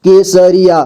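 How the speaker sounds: Kesariya in measured, separate syllables. Shown as a demonstration. Teacher breaking down Kesariya for learners, syllable by syllable. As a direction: sound out Kesariya piece by piece. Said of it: "जोड़ जोड़ करके".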